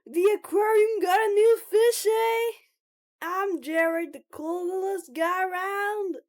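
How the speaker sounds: shouted, booming self-introduction; loud and high, playing the coolest guy; Jared. Self-styled coolest guy greeting the new recruit, shouting. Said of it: "could you please stop shouting?".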